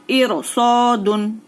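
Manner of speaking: "इर सौ दुन" slowly, with a thick R; full, heavy R. Shown as a demonstration. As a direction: recite "इर सौ दुन", R heavy, "सौ" long. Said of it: "रॉ साकिन को पुर पढ़ेंगे". ("इर" की र पुर, धीमी तिलावत)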